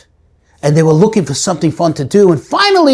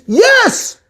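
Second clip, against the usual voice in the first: calling back. Acted. Startled man answers from below.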